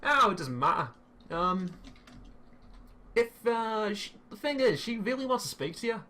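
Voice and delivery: high-pitched Australian impression drifting into Scouse; botched Australian accent. Caller pushes his made-up sister on a student, botched Australian accent. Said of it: "sound like you're doing a Liverpool accent".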